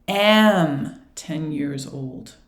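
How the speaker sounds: "am" drawn out long and loud; teacher's correction, "am" held and stressed. Shown as a demonstration. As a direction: correct it slowly, leaning hard on "am". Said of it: "we don't say have, we say".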